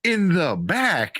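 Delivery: high, drawn-out disbelief; incredulous disbelief. Retold or imitated. Incredulous about movie character's injury.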